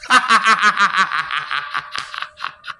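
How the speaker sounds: long staccato cackle; gloating laugh. Acted. Enjoying the sufferer's begging, gloating cackle. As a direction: cackle in drawn-out, pulsing bursts. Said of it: "where I get my laugh".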